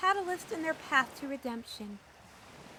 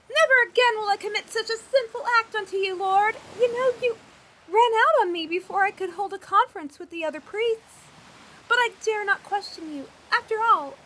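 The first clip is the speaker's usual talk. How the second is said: frantic apology; 3 semitones higher, 7 dB louder than usual. Acted.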